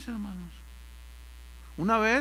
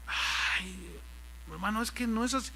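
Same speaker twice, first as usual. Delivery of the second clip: mock soothing excuse; 2 semitones higher, 7 dB quieter than usual. Pastor mock-soothing congregants.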